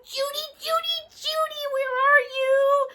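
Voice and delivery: high sing-song call to a friend. Acted. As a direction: call her name high and playful. Calling out to friend Judy.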